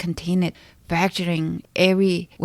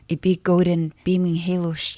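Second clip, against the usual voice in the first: ordinary delivery, nothing marked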